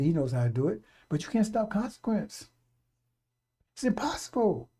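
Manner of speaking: preaching. Acted. Preacher building on consequence.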